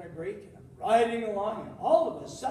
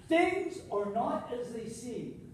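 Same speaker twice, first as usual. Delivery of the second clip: slow deliberate refrain; slower than usual. Acted. Recurring punchline refrain.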